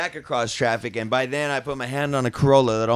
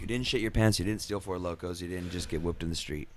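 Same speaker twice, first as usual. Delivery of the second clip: quiet self-coaching; 8 semitones lower, 9 dB quieter than usual. Retold or imitated. Mock self-talk, acting normal.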